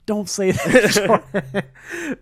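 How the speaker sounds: mock protest. Mock protest at friend's self-insult.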